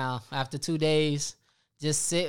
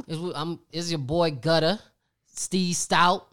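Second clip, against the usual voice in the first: ordinary talk, nothing marked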